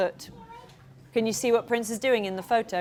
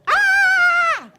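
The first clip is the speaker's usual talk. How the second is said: sudden shout; 10 dB louder than usual. Real, not acted.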